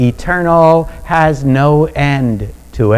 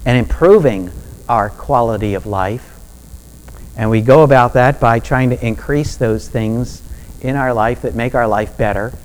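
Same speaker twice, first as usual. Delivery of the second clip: ordinary talk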